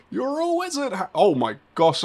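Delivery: ordinary talk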